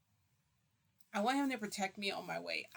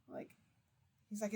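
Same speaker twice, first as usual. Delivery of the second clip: ordinary talk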